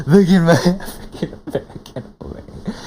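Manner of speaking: laughing through the quote, fast; laughing repeat. Retold or imitated. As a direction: repeat the line, cracking up mid-sentence.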